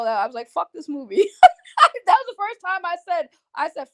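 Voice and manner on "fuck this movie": ordinary talk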